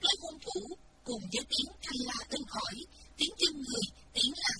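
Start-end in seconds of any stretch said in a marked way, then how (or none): none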